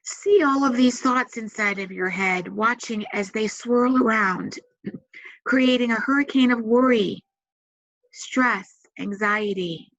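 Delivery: calm, measured meditation voice with long pauses; guided meditation. Acted. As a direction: guide them gently into relaxation, leaving space to breathe.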